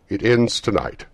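unearthly voice. Acted. Ghost answering.

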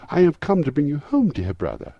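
put-on high, loud, delighted child; excited little girl. A narrator's reading. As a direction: burst out as an overjoyed little sister. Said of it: "said the child".

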